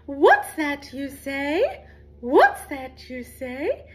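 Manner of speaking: high, loud and slow, every word drawn out; slow high sing-song call. Shown as a demonstration. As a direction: stretch it out like a playful echo for the kids to copy. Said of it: "can you repeat after me".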